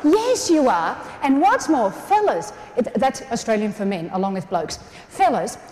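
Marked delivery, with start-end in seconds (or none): none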